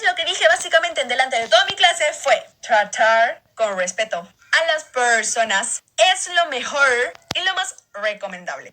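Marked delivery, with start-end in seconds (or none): 2.6-8.7 slow, lower, R sounds swallowed in "Tratar con respeto"; can't roll the R. Shown as a demonstration. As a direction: say "Tratar" and "respeto" without any R, slowly and lower. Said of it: "en serio hablaba así".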